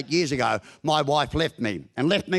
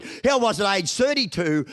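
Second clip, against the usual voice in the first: ordinary delivery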